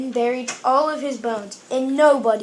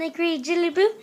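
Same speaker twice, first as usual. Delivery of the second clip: usual speaking voice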